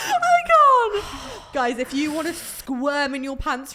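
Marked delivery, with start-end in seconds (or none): 0.0-1.5 shrieking laugh. Real, not acted. Laughing at embarrassing slip.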